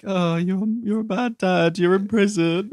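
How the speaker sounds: high-pitched mock accusation; mocking daughter. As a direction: needle him like a taunting child. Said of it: "she's trying to be like".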